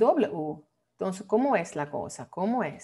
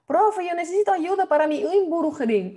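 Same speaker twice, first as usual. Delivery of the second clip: higher, louder pleading student; imitated student; 8 semitones higher, 5 dB louder than usual. Student asking the teacher for exam help, higher and pleading. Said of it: "Yo escucho todo el mundo siempre".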